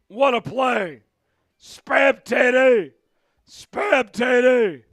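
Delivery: loud, drawn-out chant to chat; excited celebration. Real, not acted. Streamer hyping chat after the score. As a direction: cheer it out to the chat.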